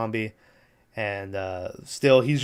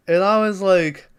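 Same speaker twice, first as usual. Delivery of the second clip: normal talking voice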